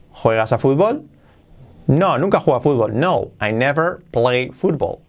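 normal talking voice

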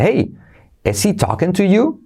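"Hey" high, then "is he talking to you" rising; sing-song rising question; hey cue. Shown as a demonstration. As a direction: pitch up on "Hey", carry it into the question. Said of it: "ponemos hey".

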